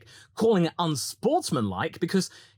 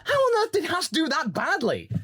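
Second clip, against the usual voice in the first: incredulous viewer; 13 semitones higher than usual. Retold or imitated. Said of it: "and you go like".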